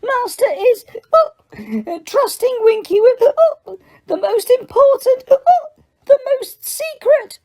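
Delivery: put-on very high drunken elf voice, slowing at the end; tipsy secretive elf. A narrator's reading. Winky boasts of her master's trust, very high. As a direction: slur it out proudly.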